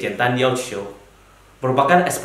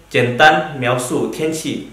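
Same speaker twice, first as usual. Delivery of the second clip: each syllable laid out slowly; slow careful Mandarin; slower than usual. Shown as a demonstration. Teacher introduces topic name to class, slow and clear. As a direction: read the Chinese term slowly for learners. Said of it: "Keenam yaitu".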